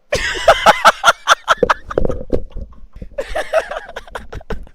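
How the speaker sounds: long, high burst of laughter; helpless cackle dying away; real laughing fit. Real, not acted. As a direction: crack up at your own line. Cracks up after quoting himself.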